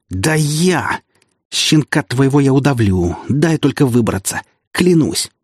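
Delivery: raging threats. Acted. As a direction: rage and threaten revenge. Wounded prince raging at traitor.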